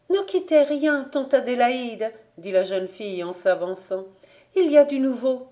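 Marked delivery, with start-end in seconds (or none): 0.1-2.1 put-on higher, eager girl's voice. Rousille stopping her aunt from undressing, higher and eager. Girl bursts in with news. 4.6-5.5 put-on higher, eager girl's voice. Rousille stopping her aunt from undressing, higher and eager. Girl announces news.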